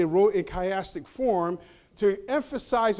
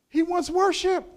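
preaching climax; 10 semitones higher, 5 dB louder than usual. Real, not acted.

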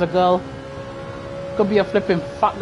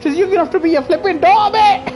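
high, fast and louder taunt; excited teasing; 8 semitones higher, 3 dB louder than usual. Real, not acted. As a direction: taunt them, fired up. Teasing rival, laughing.

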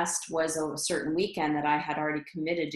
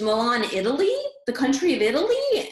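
higher and louder, disbelieving questions; shocked mom; 7 semitones higher, 6 dB louder than usual. Retold or imitated. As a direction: repeat it as if you can't believe it. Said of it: "she was in shock".

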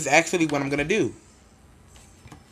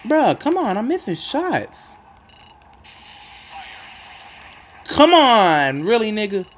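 frustrated; 7 semitones higher than usual. Real, not acted. Frustrated at missing shots.

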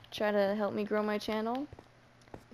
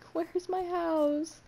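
slow, drawn-out whine, pitched up high; desperate wail; 8 semitones higher, much slower than usual. Real, not acted. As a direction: plead into the dark for home. Lost, desperately searching for home.

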